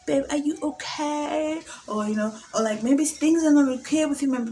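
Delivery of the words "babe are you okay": slow, high, sugary concern put on for the boyfriend; mock caring girlfriend. Texting her man, playing the overly caring girlfriend. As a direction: coo it like a worried girlfriend.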